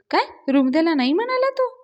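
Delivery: high-pitched, indignant disbelief; shocked question. A narrator's reading. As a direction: echo it back high and incredulous. Acted shocked reaction to bad news.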